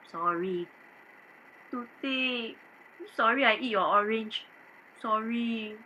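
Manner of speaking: meek apology to the maid; quiet apology. Acted. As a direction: murmur it ashamed.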